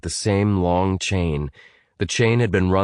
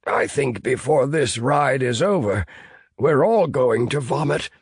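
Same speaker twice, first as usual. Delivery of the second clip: pale Fisk, queasy; 7 semitones higher than usual. Acted. Fisk voiced by narrator.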